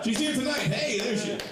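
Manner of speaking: very high put-on falsetto; mock high voice. Comic spotting girlfriend in the room, high mock voice. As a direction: pipe it up in a squeaky imitation.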